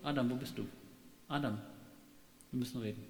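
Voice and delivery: low, soft, heavy warning; a quiet threat like before a breakup talk; hushed, loaded we-need-to-talk voice; ominous quiet. Pretend. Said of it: "Auch nicht?".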